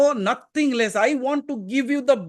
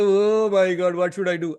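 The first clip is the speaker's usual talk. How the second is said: mock panic. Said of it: "And sometimes say".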